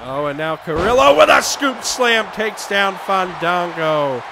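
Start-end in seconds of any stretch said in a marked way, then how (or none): none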